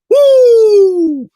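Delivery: a high, loud hype cry; loud, high "woo!" celebrating a shooter; excited whoop. Real, not acted. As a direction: whoop like the crowd after a made three. Hyped about player's shooting rhythm.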